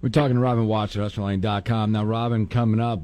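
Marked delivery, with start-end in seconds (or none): none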